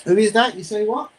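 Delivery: high, loud disbelieving cry; loud high stunned question; shocked shout. Real, not acted. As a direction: shout it in pure surprise. Surprised reaction during match.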